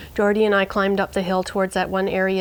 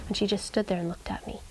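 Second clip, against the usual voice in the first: quick whisper; 8 dB quieter, faster than usual. A narrator's reading. Narrator telling the dog's return, whispered. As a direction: whisper it fast, building suspense. Suspense in dark woods.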